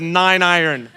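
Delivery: a touch of puffed-up bravado with a laugh; chest out. Retold or imitated. Lying to his friend about his club, mild swagger. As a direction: bluff it confidently. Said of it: "stuck my chest out and I said".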